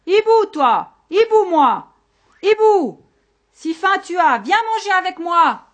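high, loud shout into the night; calling out loudly. A narrator's reading. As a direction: holler an invitation to a far-off owl. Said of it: "Alors il cria". (crier très fort, d'une voix aiguë)

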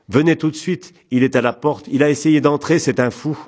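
high, loud, agitated call for help; alarmed plea. A narrator's reading. Panicked call to the police.

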